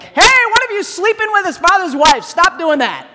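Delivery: high, loud scolding; yelling. Acted. Preacher voicing Paul yelling at the Corinthians, loud scolding. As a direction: yell it as an outraged telling-off. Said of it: "when he's yelling at the Corinthians".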